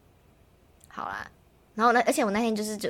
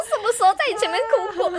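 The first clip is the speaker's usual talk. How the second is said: mock outrage, laughing through it; laughing indignant retort; 12 semitones higher, 4 dB louder than usual. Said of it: "然后我就很愤怒".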